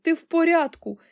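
high, loud worried plea; crying out in alarm. A narrator's reading. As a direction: beg mother desperately for an answer. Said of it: "волала пані Бакет".

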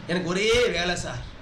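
slow, low mock complaint; mimicked excuse. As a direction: drag out the trainee's excuse. Repeats the trainee's excuse mockingly.

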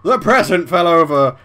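sudden loud high shout of alarm; startled yelp. Real, not acted. Player startled when the present falls, shouting out loud. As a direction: jump at the falling present.

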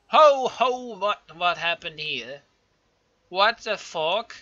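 loud, rising disbelief, the last line slow; stunned yell. Real, not acted. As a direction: shout it out in real disbelief. Shocked by a sudden rebellion.

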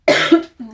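loud chesty throat clearing; cough. Real, not acted. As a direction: hack once to clear the throat. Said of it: "przepraszam za ten kaszel".

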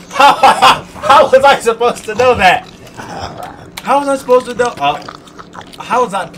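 high, loud laughing protest; laughing loudly. Real, not acted. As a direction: laugh it out in disbelief. Laughing at own mistake.

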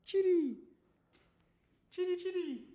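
high, singsong kitty call; little high-pitched pet call; calling the cat. Real, not acted.

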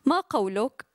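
brisk Arabic reading of her own prose; literary reading. Acted. Author reads her story to the audience, brisk reading. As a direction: read your story aloud to the audience.

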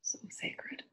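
soft, breathy whisper of self-affirmation. Real, not acted. Speaker leading listeners in affirmation, hushed whisper. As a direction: whisper it to yourself like a prayer.

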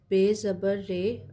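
slow, syllable by syllable spelling; spelling out letters. Shown as a demonstration. Teacher spelling बे ज़बर रे for a beginner class, slowly. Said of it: "बे के ऊपर ज़बर है".

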